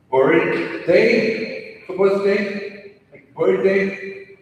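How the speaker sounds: loud, drawn-out wrong versions of "birthday"; mispronounced birthday. Shown as a demonstration. Coach showing incorrect pronunciation, "birthday" stretched out loud and wrong. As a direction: exaggerate the misplaced sounds in "birthday", slowly. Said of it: "that's incorrect".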